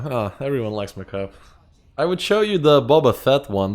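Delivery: ordinary delivery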